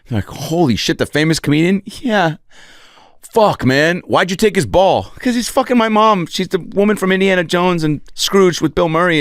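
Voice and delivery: put-on high boyish banter; kid voices. Kids trading lines about the stepdad, high voices. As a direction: rattle off the kids' back-and-forth. Kids' dialogue acted out.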